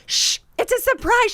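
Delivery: high, loud mock hush; retold frantic warning; urgent shushing. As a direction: shush someone in a panic. Retells urgent texted hushing.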